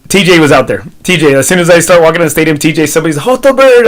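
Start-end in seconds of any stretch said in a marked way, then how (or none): 3.2-3.9 high, loud imitated holler; mock trackside call. Friend hollers as he walks into the stadium, mock shout.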